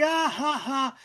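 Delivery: high mock "ha ha" laugh; spelt-out fake laugh, pitched high; imitated laughter. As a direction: mimic a gang laughing. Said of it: "They're laughing".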